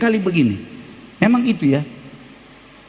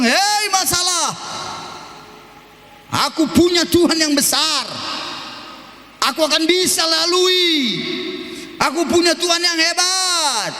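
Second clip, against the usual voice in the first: bold shout; 7 semitones higher than usual. Shown as a demonstration. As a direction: stand up and shout at the problem. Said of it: "Anda harus berani bilang". (seruan berani yang tinggi)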